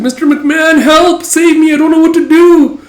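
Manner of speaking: very high, loud pleading; high panicked plea. Retold or imitated. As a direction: squeal for help. Character pleading for help.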